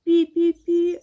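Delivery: high mouse peeps; piping storybook mouse voice; squeaking mice. A narrator's reading. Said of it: "уже тихіше пропищали мишенята".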